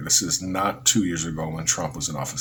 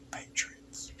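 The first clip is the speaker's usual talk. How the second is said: whispered, dropping away on the repeat; hushed, sarcastic echo; mock whisper; 16 dB quieter than usual. Mocking the Proud Boys as patriots.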